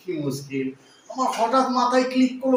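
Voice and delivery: normal talking voice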